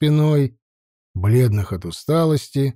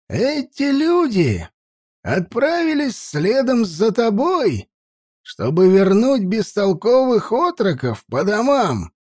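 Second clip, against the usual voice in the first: put-on old elder voice; 8 semitones higher than usual. Old elder accuses Uchai.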